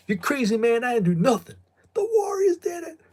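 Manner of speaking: high-pitched, loud mock accusation from a film; frantic movie accusation. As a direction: cry out the movie lines far above your own pitch. Acting out movie lines of accusation.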